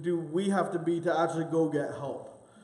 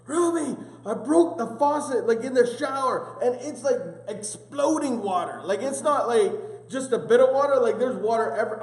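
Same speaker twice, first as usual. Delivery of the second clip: high, loud panicked shouting about a burst faucet; panicked yelling; 7 semitones higher, 5 dB louder than usual. Retold or imitated. A guy calling about a burst pipe, high panicked yelling. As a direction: yell it like water is flooding everywhere. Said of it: "He's screaming and yelling".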